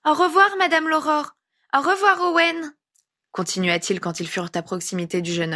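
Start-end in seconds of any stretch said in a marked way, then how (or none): 0.0-2.8 put-on very high little-kid voice; singsong high-pitched child; small child's goodbyes. A narrator's reading. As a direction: call farewells like a polite little boy. Child says goodbye in story.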